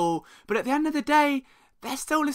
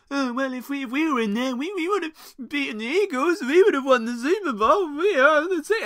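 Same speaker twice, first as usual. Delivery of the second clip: high, wavering mock voice; mocking Alvin Kamara; 5 semitones higher than usual. Podcaster mimicking a player's excuse for losing, high mocking impression. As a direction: put on a high, swooping voice to ridicule a quote. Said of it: "Alvin Kamara makes a comment that says".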